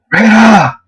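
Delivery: high, drawn-out call; calling her name. A narrator's reading. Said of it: "I said again".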